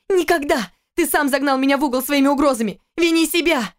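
high, cried-out shout. Acted. Wife screaming at husband by the wall. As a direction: yell it at him. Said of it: "закричала она".

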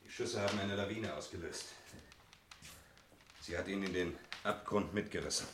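soft, sombre report; hushed and grave. Acted. Reggie reports Erik's fall into the abyss, hushed and grave. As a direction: tell the news of his death quietly.

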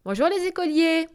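high, comical little voice; cheeky working girl's squeaky greeting; funny little voice. A narrator's reading. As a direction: call out teasingly in a high little voice. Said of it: "d'une petite voix drôle".

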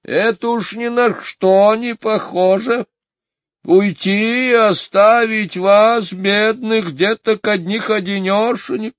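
high, slow sing-song lament; high crooning old maid. A narrator's reading. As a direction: coo over the poor little ones. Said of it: "пропела вошедшая миссис Брил".

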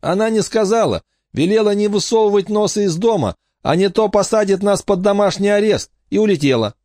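put-on worried kid repeating orders; child on phone. Child relays Miss S orders.

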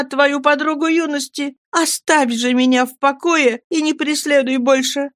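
put-on young Miriam. A narrator's reading. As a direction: refuse him gently but firmly, high voice. Miriam's voice, higher.

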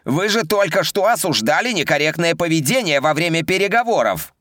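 high, outraged officer protest; indignant subordinates. A narrator's reading. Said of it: "возмутились другие подчинённые".

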